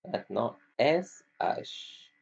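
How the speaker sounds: ordinary talk, nothing marked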